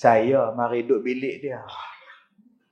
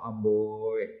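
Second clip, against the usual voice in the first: ordinary talk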